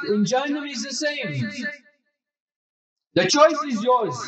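rally the congregation to effort; fervent preaching. Acted. Preacher urges the congregation toward Jannah, fervent. Exhortation section of sermon.

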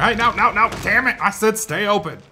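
high, loud scolding; exasperated yell. Real, not acted. Player ordering a game door to stay open, exasperated yell. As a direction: shout in frustration at something that won't obey.

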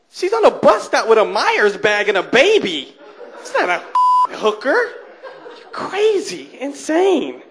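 high-pitched, incredulous retort; mock indignant. Local answering his visiting friend's question, mock indignant. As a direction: set the friend straight in exaggerated disbelief, pitch leaping.

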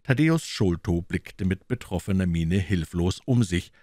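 usual speaking voice

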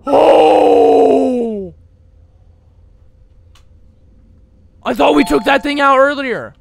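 high, loud frantic shout; panicked yelling. Real, not acted. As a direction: yell while running away. Fleeing monster.

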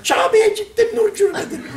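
high, silly voice of a clueless pilgrim; mock pilgrim. A foolish pilgrim bragging, in a high mock voice.

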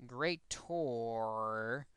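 greater drawn out very slowly; stress on greater. Shown as a demonstration. Teacher flags the inequality type, stressing a long, slow "greater". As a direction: stretch out "greater" for emphasis. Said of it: "Emphasis on greater".

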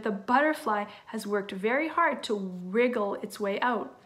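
usual speaking voice

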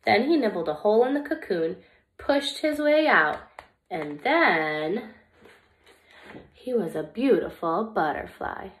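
lively, expressive storybook reading; reading to children. Acted. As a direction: read it like story time for little kids. Reads picture book to children.

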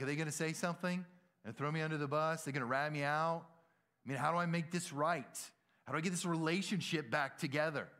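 hammed-up guilty panic; acted worry. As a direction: fret aloud, one worry after another. Acting out guilty worry about coworkers.

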